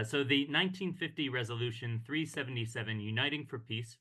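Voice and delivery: ordinary delivery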